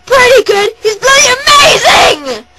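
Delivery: high, loud, slow, outraged yell; crying out loud in disbelief at the praise; indignant shout. Retold or imitated. Outraged fan correcting Annette.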